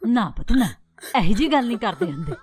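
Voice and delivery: ordinary talk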